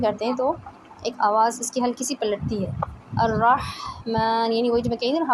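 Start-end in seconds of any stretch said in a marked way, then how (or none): 3.2-4.5 slow अर्रहमान with the ह flowing; teacher models अर्रहमान without a jolt; slow, steady breath. Shown as a demonstration. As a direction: keep breath running through अर्रहमान. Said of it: "हवा जारी रहती है".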